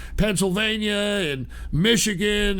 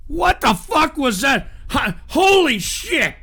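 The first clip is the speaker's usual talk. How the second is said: high, loud startled shout; shocked outburst; 6 semitones higher, 4 dB louder than usual. Real, not acted. Host reacts to the fake Trump voice, shouting in shock. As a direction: blurt it out, stunned.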